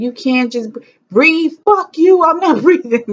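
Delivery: high, loud furious yell at the nurses; screaming back at coaching mid-contraction; screamed labor outburst. Retold or imitated. Woman in labor yelling at nurses to stop saying breathe, furious and loud. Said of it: "Everybody's like".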